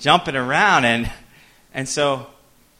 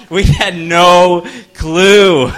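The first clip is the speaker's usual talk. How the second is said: laughing; 6 semitones higher, 12 dB louder, much slower than usual. Real, not acted. Laughing at own cluelessness.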